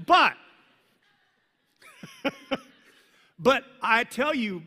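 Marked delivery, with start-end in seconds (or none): 1.9-2.6 a squeaky, high laugh; a burst of giggles. Real, not acted. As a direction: giggle at the joke. Laughs at his own joke.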